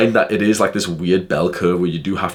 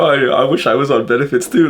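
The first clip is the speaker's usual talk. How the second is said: eager to please; 7 semitones higher, 4 dB louder than usual. Retold or imitated. Scared guy tries to befriend speaker.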